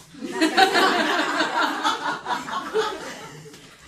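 long loud laughing fit; real helpless laughter; burst of loud laughter. Real, not acted. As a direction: crack up laughing at the text. Laughing fit while reading.

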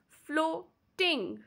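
floating as flo, pause, ting; two distinct beats in flow ting; split into syllables. Shown as a demonstration. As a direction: break flow ting apart. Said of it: "two syllables".

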